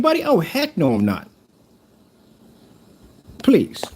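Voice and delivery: furious shouting. Real, not acted. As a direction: blow up at the rival. Angry rant at rival seller.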